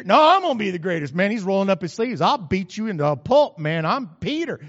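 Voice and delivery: loud, fast, ready-to-fight threat; tough Peter. Retold or imitated. Peter squares up to the others, loud and threatening. Peter rolling up his sleeves.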